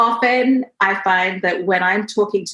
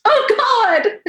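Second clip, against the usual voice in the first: put-on much higher, louder wail of a small child; imitating her four-year-old wailing, much higher; crying child; 12 semitones higher, 4 dB louder than usual. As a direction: play the little girl crying from the splinter. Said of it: "she was like".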